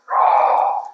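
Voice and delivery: breathy imitated roar, loud; tiger roar. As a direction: roar out like the great cat. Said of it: "her full-throated roar".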